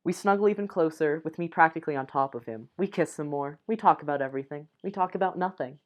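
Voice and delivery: plain reading voice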